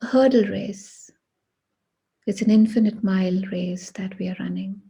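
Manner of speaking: ordinary talk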